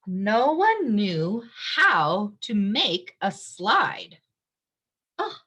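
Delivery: ordinary delivery, nothing marked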